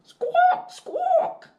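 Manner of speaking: very high, loud squawking bird; parrot squawk. Acted. Teacher reading a picture book, voicing the parrot: high loud squawk. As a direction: screech it like a parrot.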